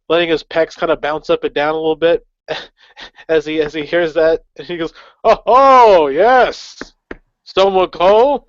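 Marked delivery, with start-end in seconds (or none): 5.2-8.4 showy bard voice. Acted. Bard answers in character.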